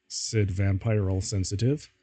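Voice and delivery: ordinary talk, nothing marked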